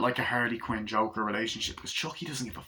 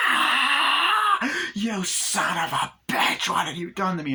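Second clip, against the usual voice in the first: piercing scream into drawn-out outrage; shriek, then curse slowly; high, loud furious doll imitation; horrified scream; 9 semitones higher, 5 dB louder, slower than usual. Doll screams in horror at Chucky.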